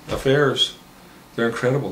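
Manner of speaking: usual speaking voice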